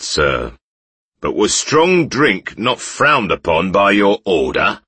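loud mocking bandit; jeering. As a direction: sneer at the drunk knight. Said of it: "jeered the first man".

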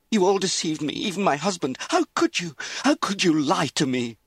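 very high, loud, anguished accusations; outraged cry. A narrator's reading. Deceived wife confronts her mother, outraged cry. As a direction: let fury and hurt pour out at full voice. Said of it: "Lizzie cried".

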